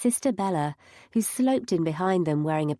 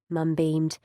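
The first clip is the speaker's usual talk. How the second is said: usual speaking voice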